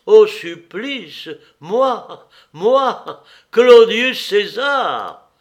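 loud high slow disbelieving wail; horrified cry. A narrator's reading. Nero cannot believe he is condemned, loud high wail. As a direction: shriek each word as if unbelievable.

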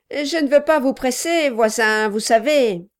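a loud, pushy little man; gruff gravelly dwarf voice. Acted. Quilp speaks.

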